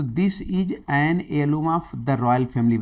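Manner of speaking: slow, deliberate read of the question; stretched-out exam reading. Shown as a demonstration. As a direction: read each word out slowly for the class. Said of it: "reading question slowly".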